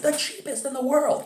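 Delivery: very high, comical commercial pitchman; Phil the carpet store guy. Retold or imitated. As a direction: hawk it in a squeaky-high mock ad voice. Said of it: "and he's like".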